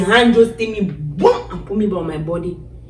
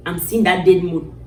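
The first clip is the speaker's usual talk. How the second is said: ordinary delivery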